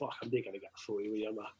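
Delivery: fast, hushed letdown mumble; disappointed mutter. Retold or imitated. Fan denied a photo with [name], quick mutter. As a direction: grumble it quickly under your breath.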